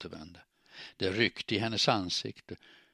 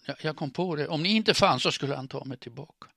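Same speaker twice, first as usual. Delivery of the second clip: higher, louder, distraught confession; distraught outburst; 7 semitones higher, 8 dB louder than usual. A narrator's reading. Woman confessing why she came, distraught outburst. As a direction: blurt it out, building in volume.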